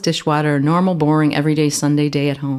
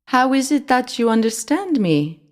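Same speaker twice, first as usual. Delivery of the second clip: high French mouse, asking slowly; puzzled little mouse, drawn out; 8 semitones higher than usual. A narrator's reading. Mouse wondering why the boy understands, slow and high. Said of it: "asked the mouse slowly".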